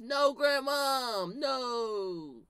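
slow, barely acted half-hearted plea; flat fake plea. Cousin not even pretending to cry to grandma, flat plea. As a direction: say it without even faking the tears. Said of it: "he not even act like he crying".

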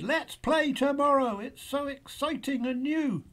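high, loud and thrilled; excited shout. A narrator's reading. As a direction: shout it with delight, pitched right up. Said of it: "Boo shouted".

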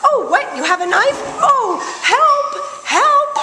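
high, hammed-up cries for help; mock panic. [name] mocks a naive host crying for help in high mock panic. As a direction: play the helpless victim, squealing. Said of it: "she's like".

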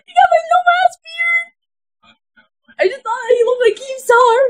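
very high, squealing through laughter; helpless high-pitched hilarity; laughing shriek. Real, not acted. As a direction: shriek it while cracking up. Cracking up at actor's look.

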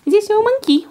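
high, sing-song question to the dog; baby talk. Shown as a demonstration. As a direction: coo it up high at the puppy. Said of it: "repeated in pet voice".

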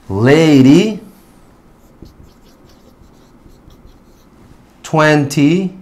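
lady, twenty, tiny as isolated drill words, final y rising into 'ee'; long E endings. Shown as a demonstration. Teacher reading example words for learners, long E endings. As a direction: lift the long 'ee' ending of lady, candy, story, leaving gaps. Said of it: "va a sonar como un long E sound".

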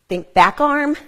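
coaching call. Real, not acted. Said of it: "coach cues mid-workout".

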